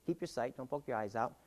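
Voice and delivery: ordinary talk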